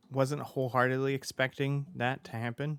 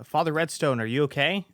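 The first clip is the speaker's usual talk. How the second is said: raised, louder worried call; shouting through the door; 4 semitones higher, 6 dB louder than usual. Acted. Game master voicing people outside the door, shouting. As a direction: call out to someone behind a door. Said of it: "yelling and shouting".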